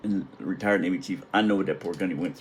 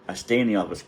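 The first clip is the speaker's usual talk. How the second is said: normal talking voice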